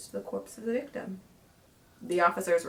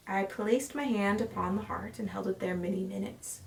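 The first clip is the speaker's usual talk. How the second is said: usual speaking voice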